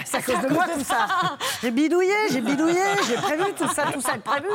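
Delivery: gleeful joking, laughing; laughing mock confession. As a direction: laugh through a fake confession. Jokes she sabotaged EDF.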